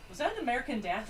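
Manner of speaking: ordinary delivery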